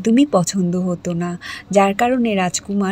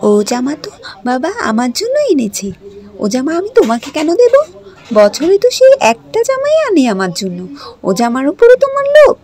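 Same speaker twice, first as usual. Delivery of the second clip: defiant retort; 5 semitones higher, 6 dB louder than usual. Acted. Ragini arguing back at stepsister.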